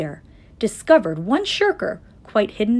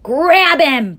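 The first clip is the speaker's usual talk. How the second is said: loud, higher mob command; shouting; 7 semitones higher, 9 dB louder than usual. A narrator's reading. Mob orders Horton seized, shouting. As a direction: yell the order, stretching it. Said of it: "they shouted".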